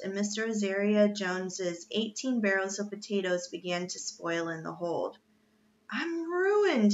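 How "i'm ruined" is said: high-pitched despairing wail; acted wail, pitch soaring. A narrator's reading. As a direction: wail it out in dismay. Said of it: "he wailed".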